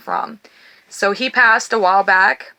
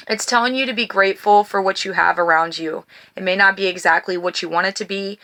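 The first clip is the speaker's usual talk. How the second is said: ordinary talk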